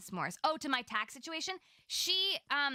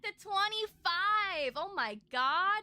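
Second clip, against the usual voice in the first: gushing thanks; 7 semitones higher than usual. Real, not acted. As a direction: gush with gratitude, stretching the words. Gushing thanks for super chat.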